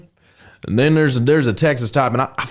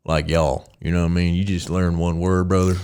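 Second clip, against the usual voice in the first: Texan accent; 6 semitones lower, 4 dB quieter than usual. Shown as a demonstration. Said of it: "Just get one word".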